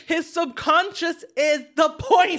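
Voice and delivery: high, loud, slow and laughing; exasperated protest. Real, not acted. Co-host arguing back about dream sequences, exasperated. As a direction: cry it out slowly in amused disbelief.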